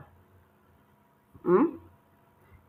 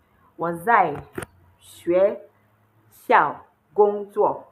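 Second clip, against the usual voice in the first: slow and louder, one syllable at a time; slow model sentence; 6 dB louder, much slower than usual. Shown as a demonstration. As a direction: spell the sentence out for the class. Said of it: "And it means I am a teacher".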